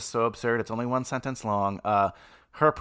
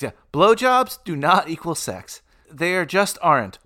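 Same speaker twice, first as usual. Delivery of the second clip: ordinary talk, nothing marked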